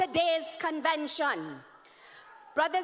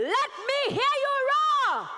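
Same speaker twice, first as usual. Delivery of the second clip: higher, louder crowd call; crowd call, building; 12 semitones higher, 6 dB louder than usual. Real, not acted. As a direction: push the crowd harder.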